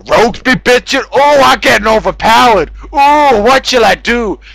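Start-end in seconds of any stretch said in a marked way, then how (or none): none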